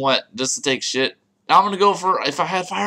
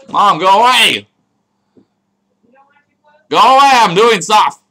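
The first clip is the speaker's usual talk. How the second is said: loud, raised shout to another room; real annoyed yell; 5 semitones higher, 10 dB louder than usual. Real, not acted. Gamer shooing mom away mid-recording, annoyed yell. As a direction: yell to get rid of an interruption.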